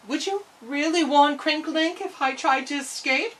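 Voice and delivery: ordinary delivery, nothing marked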